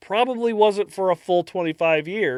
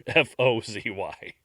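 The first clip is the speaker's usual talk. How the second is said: ordinary talk, nothing marked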